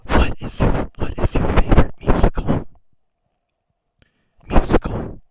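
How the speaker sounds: loud breathy phone whisper like a horror caller; unintentionally creepy phone voice; Scream caller voice. Real, not acted. Guest asking the streamer a question, creepy whisper. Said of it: "I sound like the dude from Scream".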